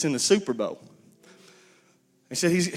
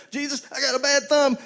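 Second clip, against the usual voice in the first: a hint of a whiny believer, high; mock complainer; 9 semitones higher than usual. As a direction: mimic a petty complaint to Jesus, pitched up. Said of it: "when we come to him and say".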